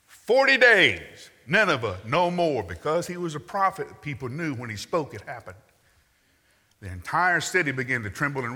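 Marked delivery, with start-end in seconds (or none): 0.1-2.6 much higher and louder, slow prophet's cry; booming proclamation. Shown as a demonstration. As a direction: thunder it out over the whole city. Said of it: "That's his whole sermon".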